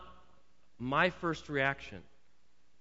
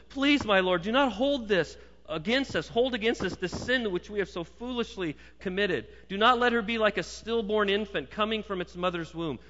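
higher, louder urgent plea; Aaron pleading; 7 semitones higher, 5 dB louder than usual. Acted. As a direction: beg Moses desperately for mercy. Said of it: "And he said to Moses".

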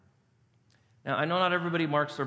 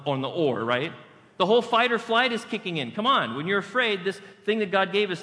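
normal talking voice

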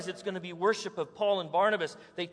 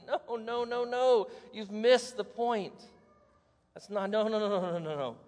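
higher, insistent string of no; frustrated correction; 4 semitones higher than usual. Retold or imitated. Apostles telling the crowd they missed the point, insistent. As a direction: shake them off the wrong idea.

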